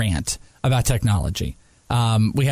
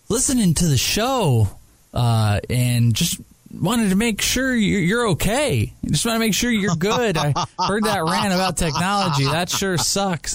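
lightly voiced worried producer, pitched higher; mock concerned producer; 7 semitones higher than usual. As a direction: play the producer checking in, gently concerned. Imitating concerned producer, far high.

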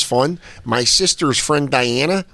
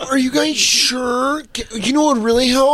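mock pleading; 8 semitones higher than usual. Friend mocks her pleading with the cops, high and whiny. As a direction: mimic her begging the cops sweetly.

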